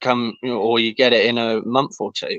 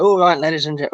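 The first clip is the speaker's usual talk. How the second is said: higher, announcer-style ladies and gentlemen; hammed-up mic voice; 7 semitones higher, faster than usual. Mocking how hosts open a show, hammed-up mic voice. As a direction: launch into a showy host opener. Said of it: "where they go".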